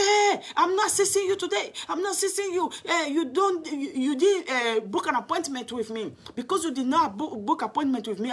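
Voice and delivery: high, sharp imitation of a brushing-off manager; dismissive manager. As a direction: shoo her away like a pest, pitched well up. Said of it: "He said".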